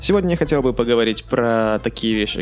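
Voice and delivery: ordinary talk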